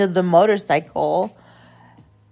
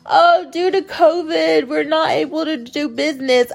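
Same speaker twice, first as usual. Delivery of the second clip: higher, mocking excuse voice; mimicked excuse; 8 semitones higher than usual. Woman quoting their excuse, mocking imitation. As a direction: parrot a lame excuse. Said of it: "you know what their excuse was?".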